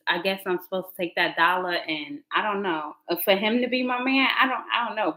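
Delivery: normal talking voice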